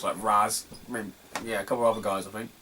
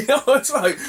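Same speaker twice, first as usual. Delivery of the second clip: ordinary delivery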